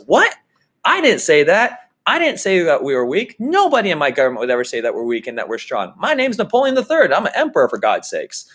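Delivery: an offended emperor sputtering over the paper; outraged Napoleon. Retold or imitated. Napoleon reading the newspaper at breakfast, mock outrage. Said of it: "and goes".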